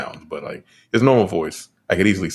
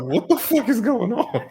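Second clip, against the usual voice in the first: much higher, laughing, incredulous; mock baffled shriek while laughing; bewildered squeal; slower than usual. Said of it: "I was like".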